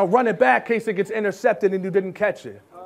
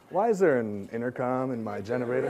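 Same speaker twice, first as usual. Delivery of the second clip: mock mom; 11 semitones lower, 10 dB quieter than usual. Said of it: "Mom calls him ... like".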